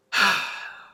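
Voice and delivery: long heavy despairing sigh; large sigh. Acted. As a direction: let out a big weary sigh.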